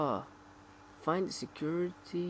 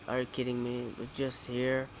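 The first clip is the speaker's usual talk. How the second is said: ordinary talk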